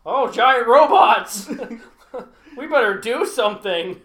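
loud, drawn-out mock realisation; mock sudden alarm. US government finally notices giant robots, loud hammed-up alarm. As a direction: exclaim it loudly, slowly, as belated panic. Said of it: "United States government to be like".

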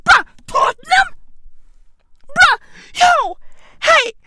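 high, stunned question; can't believe the news; shocked disbelief. Real, not acted. Arsenal fan reacting to Tottenham deal, stunned high disbelief.

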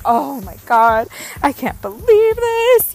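hammed-up shocked viewer, drawn out; mock shock. Viewer reacting to a character death, high and drawn out. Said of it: "You're just like".

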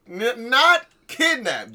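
"not kidnapped" repeated slowly, with "not" stressed. Shown as a demonstration. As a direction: press hard on "not" and say it slowly. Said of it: "I repeat".

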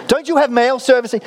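high, incredulous mock-American question; badly faked American accent; terrible American accent. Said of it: "This is a terrible accent".

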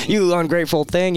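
hammed-up indignant father, pitched high and loud; mock his own outrage at his son, high and loud; mock angry rant. Veteran retelling how he scolded his son, in a hammed-up high indignant voice. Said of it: "And so I was just so mad".

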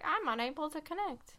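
high-pitched mock-sad dinosaur voice; play-acted pitiful error-message dinosaur; sad little dinosaur. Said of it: "little dinosaur that looks sad and is like".

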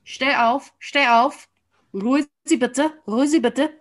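high, loud Austrian teacher commands; Austrian accent. Retold or imitated. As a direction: bark orders like a strict Austrian teacher. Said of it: "I think I can do an attempt at this accent".

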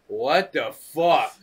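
mock appalled bystander, very slow; stunned disbelief. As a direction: stretch out each word like a gawping onlooker. Voices staring family's stunned reaction.

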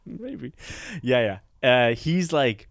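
normal talking voice

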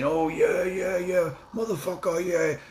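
high-pitched hyped-up shouting of yeahs; mock hype man. Mocking the hype man hollering behind [name]. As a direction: ham up a backstage hype man cheering. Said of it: "[name] being like a hype man".

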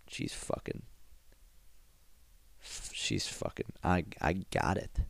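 breathless whispered inner voice. Retold or imitated. Shawn's thoughts while staring at the girl, whispered. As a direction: whisper it to yourself in disbelief. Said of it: "in his brain, he's like".

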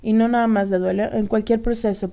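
ordinary talk, nothing marked

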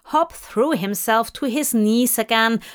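ordinary delivery

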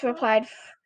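plain reading voice

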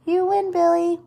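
high, loud congratulation; shouted cheer. A narrator's reading. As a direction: call it out to the winner. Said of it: "shouted the other boys".

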